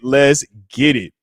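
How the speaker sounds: slow let's get it. Real, not acted. Streamer hyping viewers, slow drawn-out let's get it. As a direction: draw it out slowly.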